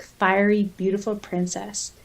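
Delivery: ordinary talk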